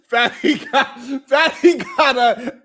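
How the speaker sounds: slow words through real laughter; can barely get it out for laughing. Real, not acted. Host cracking up while retelling the late-night call.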